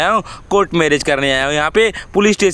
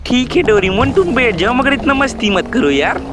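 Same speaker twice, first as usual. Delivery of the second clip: higher, sweet bride voice; shy bride speaking to Doraemon, higher voice; 6 semitones higher than usual. Acted. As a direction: play the shy new bride gently scolding.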